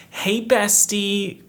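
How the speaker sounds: high, drawn-out mock-sweet greeting; chirpy bestie greeting. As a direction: sing it out slowly to a bestie. Greeting the bookstore lady, acted out.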